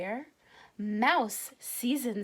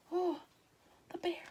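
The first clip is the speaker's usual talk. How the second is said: higher, softer pitying sigh; 6 semitones higher, 6 dB quieter, faster than usual. A narrator's reading. Narrator pitying the bear, soft and high. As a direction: feel sorry for the bear.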